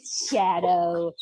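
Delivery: ordinary talk, nothing marked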